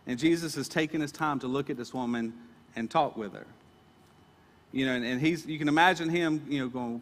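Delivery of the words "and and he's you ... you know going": usual speaking voice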